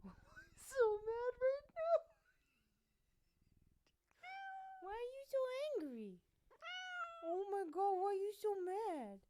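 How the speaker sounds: squeaky, teasing pet-parent voice; cooing at a pet. Real, not acted. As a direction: coo it high over an angry cat. Cooing at his angry cat.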